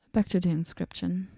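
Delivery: ordinary talk, nothing marked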